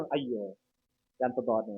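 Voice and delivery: soft, low mock pity; feigned sympathy. Neighbour watching a house being demolished, in feigned pity. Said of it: "లోపల ఏంటకున్నారు?".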